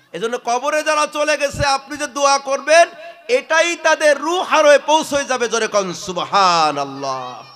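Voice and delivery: rousing pulpit climax; full-force preaching. Real, not acted. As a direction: build fervently, then cue the congregation's response. Preacher builds to congregational response.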